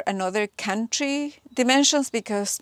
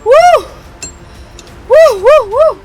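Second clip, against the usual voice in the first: cheering; 14 semitones higher, 13 dB louder than usual. Real, not acted. Cheering as part comes free.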